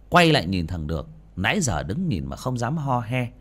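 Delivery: ordinary delivery, nothing marked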